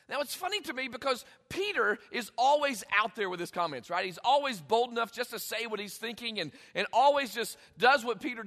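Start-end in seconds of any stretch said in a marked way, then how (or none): none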